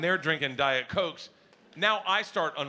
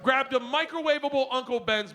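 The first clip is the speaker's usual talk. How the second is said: exasperated shout; 8 semitones higher than usual. Real, not acted. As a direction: spit out the insult, fed up. Exasperated outburst about daughter.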